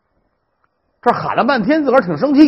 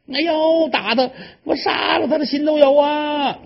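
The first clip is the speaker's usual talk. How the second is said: wailing defiance; 9 semitones higher than usual. Acted. Stepmother wails back at husband.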